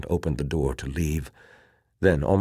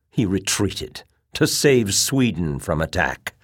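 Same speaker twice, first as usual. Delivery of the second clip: ringing, louder, scornful tirade; bitterness and scorn; 6 semitones higher, 7 dB louder than usual. A narrator's reading. Officer on his feet arguing against Swedish aid, bitter and scornful. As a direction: let the bitter questions ring out, raised. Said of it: "his words rang with bitterness and scorn".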